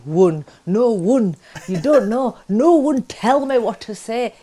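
slow Yorkshire-Pakistani accent, "one" said as "wun"; [name]'s Yorkshire-Pakistani voice, "no one" said as "no wun"; Yorkshire-Pakistani "One, no one", slow and deliberate. Shown as a demonstration. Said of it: "I would have to write in W U N".

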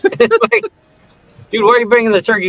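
high, fast incredulous yell-ish; mock outrage. Joking about the host's turkey, high and fast. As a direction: react in high disbelief.

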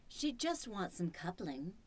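ordinary talk

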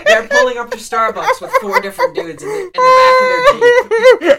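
high, loud laughing squeal; laughing hysterically. Real, not acted. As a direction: talk through a big laughing fit. Laughing fit while describing.

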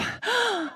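a quick soft sharp intake of breath; a hammed-up little gasp of shock; mock gasp.

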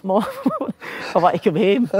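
half-laughing, plead to come home; mock homesick plea. Nervous new player wanting to phone his mom, mock homesick plea.

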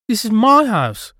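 put-on high, very loud little-girl shout; ghost girl's yell. A narrator's reading. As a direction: claim it at full volume. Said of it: "her voice louder than should have been possible".

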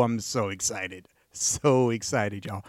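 excited. Real, not acted. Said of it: "In case you can't hear in my voice".

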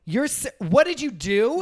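high and loud outrage; shrill outrage. Acted. Lauren throwing Heidi's words back, high and loud. Acted quarrel.

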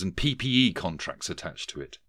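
ordinary delivery

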